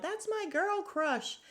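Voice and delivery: high, slow drawn-out call; retold yell. Sutton yelling across the table, high mock call. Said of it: "Sutton ends up yelling out".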